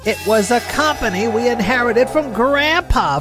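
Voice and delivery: high-pitched exasperation; exasperated family quarrel. Acted. Acted family quarrel over lost company.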